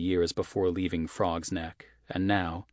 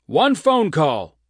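yelling; 10 semitones higher, 11 dB louder, slower than usual. Acted. Sheriff yelling at prisoner.

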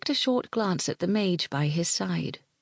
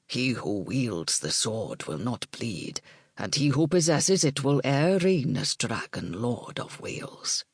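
solemn druid blessing; 4 semitones lower than usual. Acted.

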